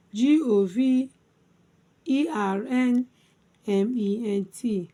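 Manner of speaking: letter groups starting firm, settling on the last; spelled by syllables. Shown as a demonstration. Teacher spelling government for learners, syllable by syllable. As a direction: repeat the spelling in three separated chunks. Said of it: "Let's spell".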